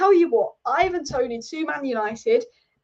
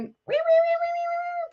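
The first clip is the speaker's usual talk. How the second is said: very high-pitched imitation rat squeals; rat squeak impression. Shown as a demonstration. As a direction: squeak "wee wee" like a mock rat. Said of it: "if I could sound like a rat".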